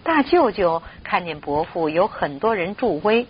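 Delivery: plain reading voice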